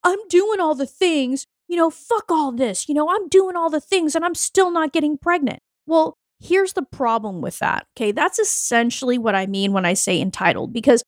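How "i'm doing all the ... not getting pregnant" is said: higher, exasperated imitation; higher mock complaint about doing all the things; mock frustrated self-talk. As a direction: complain like you've done everything and got nothing. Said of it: "We start telling ourselves".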